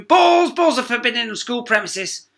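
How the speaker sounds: high, loud barking exclamation; outraged shout. Acted. Teacher confiscates marbles, loud high outrage. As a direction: grab them in a fury.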